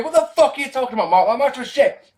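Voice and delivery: high, rushed mock outrage; imitating objecting viewer. A viewer objecting to Mark's praise of the match, indignant. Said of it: "just before you go".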